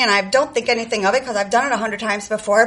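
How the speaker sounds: ordinary talk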